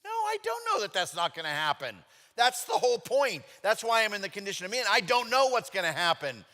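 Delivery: high, loud, rising frustration; angry protest. Retold or imitated. Arguing with God about his wife's cancer, angry and loud. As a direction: push back hard, nearly shouting. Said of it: "which made me even angrier".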